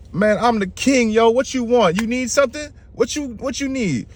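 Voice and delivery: high-pitched, offhand royal questioning; impatient king Bali. Acted. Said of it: "And then Bali was like".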